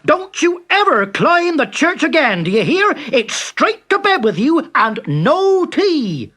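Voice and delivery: loud, high scolding father; father scolding Timothy; angry father. A narrator's reading. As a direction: tell him off hard, sending him to bed. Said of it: "roared his father".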